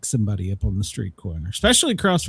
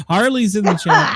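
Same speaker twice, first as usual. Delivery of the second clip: excited greeting; 9 semitones higher, 6 dB louder than usual. Real, not acted.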